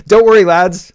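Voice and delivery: loud, high, hammed-up pep talk to the lads; mock cheery reassurance. George rallying the lads, loud mock cheer. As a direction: shout "worry" up high like a jolly officer. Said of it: "says George".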